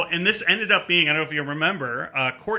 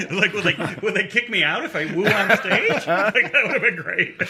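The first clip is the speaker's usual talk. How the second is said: higher-pitched, laughing through the words; 6 semitones higher, 3 dB louder than usual. Real, not acted. Comic imagining getting kicked out for a woo on stage, laughing. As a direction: joke it out while cracking up. Joking about wooing on stage.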